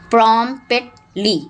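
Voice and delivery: Promptly in separate beats, pausing between the parts; broken into syllables. Shown as a demonstration. Teacher dividing a dictionary word for pupils, syllable by syllable. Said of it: "break them in the syllables".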